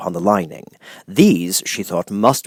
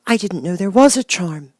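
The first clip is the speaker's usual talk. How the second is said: surprised; 11 semitones higher than usual. A narrator's reading. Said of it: "answered Dorothy in surprise".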